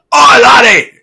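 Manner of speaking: high, loud mock-Scottish cry; bad Scottish accent. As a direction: burst out as a cartoon Scottish dwarf. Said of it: "Scottish. ... I can't do that accent".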